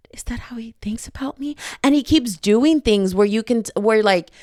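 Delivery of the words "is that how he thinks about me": soft unvoiced wondering; the heroine hushing her own surprise; whispered. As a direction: whisper it to yourself in awe. Heroine's private wondering thought.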